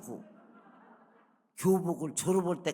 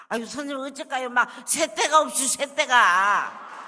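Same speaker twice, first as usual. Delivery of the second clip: Jeolla dialect; 9 semitones higher, 11 dB louder than usual. Retold or imitated. Flustered girl speaking dialect.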